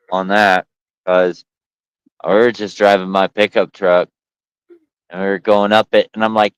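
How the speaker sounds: ordinary talk, nothing marked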